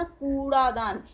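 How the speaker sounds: कूड़ादान with the ऊ drawn out slowly; a slow कूड़ादान with a long ऊ; long ऊ demo. Shown as a demonstration. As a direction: hold the ऊ in कूड़ादान. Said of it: "इसे बड़ा ऊ की मात्रा कहा जाता है".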